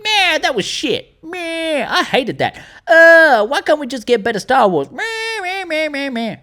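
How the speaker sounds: high-pitched whiny American caricature; mock American accent. Fans grumble outside the cinema, mock American accent. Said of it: "why they all have American accents".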